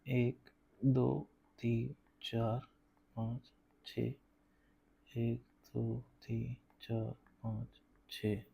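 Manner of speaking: soft, low, slow count; counting to oneself. Real, not acted. Teacher counting off points for the homework figure, quiet counting to oneself. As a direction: count quietly under your voice, leaving gaps.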